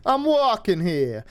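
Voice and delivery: gangster-movie New Yorker, slow and drawn out; mock New York street accent. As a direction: Brooklyn tough-guy line. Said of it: "That was good".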